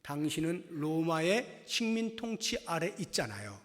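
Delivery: ordinary delivery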